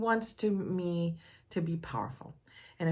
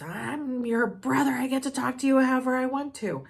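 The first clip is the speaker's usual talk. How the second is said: raised, higher brother voice, insisting he has the right; mock angry brother; 7 semitones higher, 7 dB louder than usual. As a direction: imitate the brother snapping back at his sister. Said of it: "my brother starts yelling at me".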